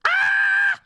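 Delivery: high piercing shouted whoop; battle cry. Acted. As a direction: whoop like a ranger.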